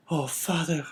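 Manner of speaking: put-on high, pleading girl's voice; a daughter begging her father's pardon; tearful young girl. A narrator's reading. Daughter pleads with father, high and tearful.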